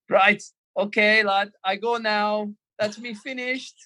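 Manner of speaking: high, slow mock Scouse-German announcement; Scouse German accent. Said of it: "this weird Scouse German accent".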